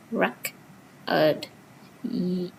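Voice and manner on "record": "Record" broken up slowly, REC... ord; first-syllable stress. Shown as a demonstration. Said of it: "Starting stress from here".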